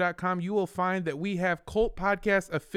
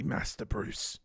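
butler voice. Pretend. Host finishing the Batman butler quote, hushed. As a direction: murmur it like a kindly old butler. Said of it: "Mister Wayne?".

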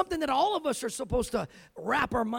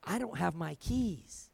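soft, slow mumble of dawning worry; mumbled worry; 6 semitones lower, 9 dB quieter, slower than usual. Retold or imitated. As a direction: say it low and slow to yourself. Recruit quietly realizes keys missing.

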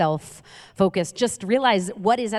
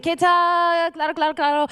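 mock Spanish accent; 9 semitones higher than usual. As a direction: fake fluent Spanish with flair. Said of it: "I don't speak Spanish".

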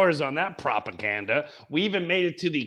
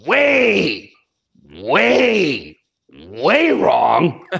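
each "way" loud and stretched out; exaggerated stress; 6 dB louder, much slower than usual. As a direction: swoop through every "way" for comic effect. Comic hammering of how wrong.

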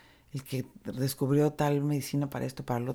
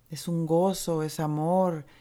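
normal talking voice

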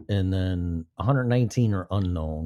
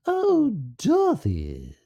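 slow, high-pitched put-on cry to Dorothy; high character voice; 11 semitones higher, slower than usual. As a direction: draw it out in a high, silly voice. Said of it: "when I do voices".

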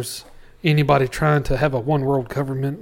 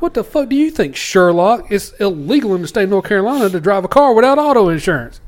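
retold angry rant; 6 semitones higher, 6 dB louder than usual. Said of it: "I was like".

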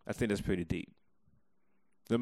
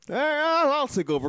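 loud sharp voice; 7 dB louder than usual. Real, not acted. Co-host barging in loudly.

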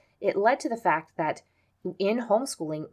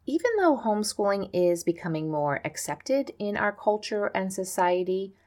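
ordinary delivery

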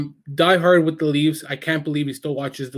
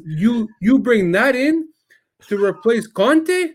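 high, slow, drawn-out disbelief; imitating incredulous father; 7 semitones higher, 3 dB louder, slower than usual. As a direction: drag out the question in mock outrage. Retold father incredulous at new manager.

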